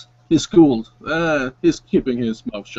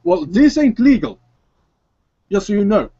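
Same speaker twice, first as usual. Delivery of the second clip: much higher, sly dealer voice; shady dealer; 7 semitones higher, faster than usual. Acted. Javier warns the illegal guns cost extra, higher and sly. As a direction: slip him illegal guns with a wink. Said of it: "hands them over".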